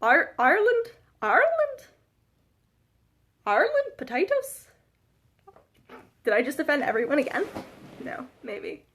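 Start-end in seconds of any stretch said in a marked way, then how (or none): 0.0-4.7 high-pitched, questioning fake Irish accent; badly faked Irish accent, leaping up in pitch; attempted Irish accent. Shown as a demonstration. Vlogger attempting Irish for a viewer, badly faked accent. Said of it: "able to do an Irish accent".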